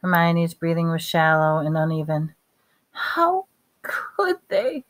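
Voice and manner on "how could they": high, slow, choked half-whisper. A narrator's reading. Hermione, breathing shallow and uneven, choked up. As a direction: draw it out as if the throat is closing. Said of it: "she choked".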